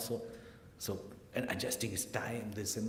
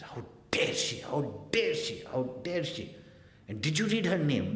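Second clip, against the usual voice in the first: acted outrage, pitched up; mock-furious mother, building higher and louder; 5 semitones higher, 3 dB louder than usual. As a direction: sputter in indignation, punching each repeat. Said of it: "She was furious".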